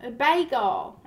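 drawn-out Southern "bagel"; Southern accent. Retold or imitated. Retelling the woman's TikTok challenge word, in a Southern accent. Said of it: "really Southern".

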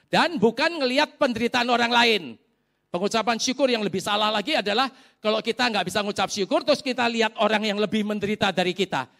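loud, high, urgent sermon delivery; emphatic preaching. Real, not acted. Preaches at full force. (berkhotbah tegas dan lantang)

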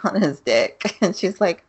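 ordinary talk, nothing marked

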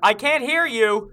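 loud with plugged ears; yelling. Acted. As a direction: shout over your own earplugs. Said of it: "there's no need to yell".

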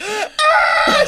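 very high mock crying shriek; imitated bawling scream; waterworks wail. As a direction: burst into an exaggerated wail. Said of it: "the waterworks start".

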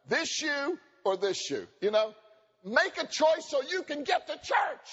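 slow, drawn-out impatience; exasperated husband. Retold or imitated. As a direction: plead for her to hurry up. Mimics wife choosing shoes.

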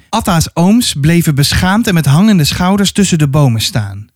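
usual speaking voice

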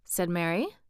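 ordinary delivery

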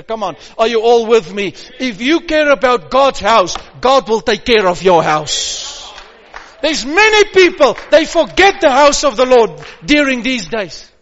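loud urgent preaching; rally the church; preaching at full force. Real, not acted.

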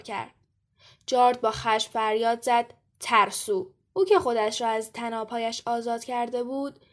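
composed storyteller's voice; story narration. A narrator's reading. Reads a story aloud.

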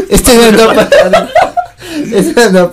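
words squeezed out through laughter; giggling retelling; laughing. Real, not acted.

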